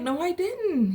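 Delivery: "No, I didn't" pitched high and protesting; insistent denial. Retold or imitated. Narrator to Kat, high mock-innocent denial.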